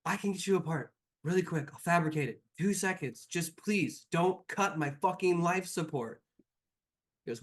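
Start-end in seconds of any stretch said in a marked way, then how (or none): 0.0-6.2 hurried pleading. Acted. Xander pleads quickly.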